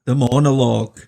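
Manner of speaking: announcer. Acted. Echoing the show title.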